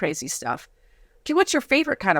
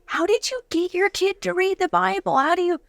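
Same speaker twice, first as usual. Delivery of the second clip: high, mock-earnest mom voice; light parody of a curious mother; imitating a curious mom; 7 semitones higher than usual. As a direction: play the eager mom asking for the secret. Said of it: "she's like".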